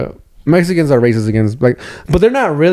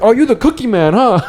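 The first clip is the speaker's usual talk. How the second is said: higher, sneering put-on voice; mock taunt; 8 semitones higher than usual. Robbers taunting the cousin, higher mock sneer. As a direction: taunt him like a street tough.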